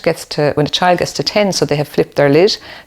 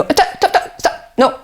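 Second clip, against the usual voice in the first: scolding parent; 13 semitones higher than usual. Retold or imitated. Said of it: "you know".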